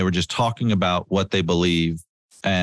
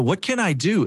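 much higher and eager, rising to help; eager offer; 10 semitones higher than usual. Retold or imitated. Wife rolling down the window to help, eager and bright. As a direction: leap at the chance to help. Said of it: "she goes".

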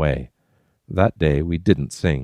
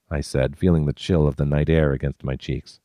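ordinary delivery

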